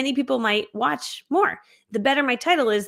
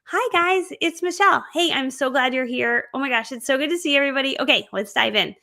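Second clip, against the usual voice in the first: fake nice voice; 3 semitones higher than usual. Shown as a demonstration. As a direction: sound overly nice so people like you. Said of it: "My fake voice sounds like this".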